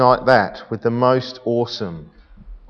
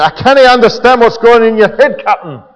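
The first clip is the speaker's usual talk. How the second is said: loud, high-pitched mock Scottish engineer; Scottish accent; 6 semitones higher, 6 dB louder than usual. Scotty protesting to his captain, in a loud Scottish accent. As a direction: play an alarmed Scot with a thick Scottish accent. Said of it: "saying in his Scottish accent".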